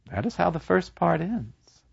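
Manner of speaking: ordinary delivery, nothing marked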